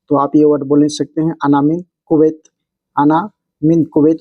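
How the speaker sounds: ordinary talk, nothing marked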